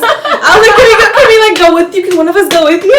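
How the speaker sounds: very high, loud, laughing plea; hammed-up high, loud begging. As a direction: beg the police to take you along. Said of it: "He's like".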